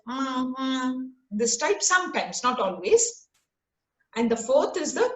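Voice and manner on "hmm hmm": steady, softer nasal hum. Shown as a demonstration. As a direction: hum it through the nose. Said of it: "speaking from your nasal voice".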